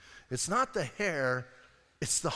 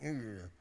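soft disgusted grunt; disgusted ugh; 5 semitones lower, 9 dB quieter than usual. Real, not acted. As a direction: groan in disgust. Disgusted reaction.

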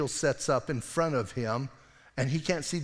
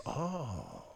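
stunned oh; 5 semitones lower, 10 dB quieter than usual. Retold or imitated. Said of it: "he sees the angel and he goes".

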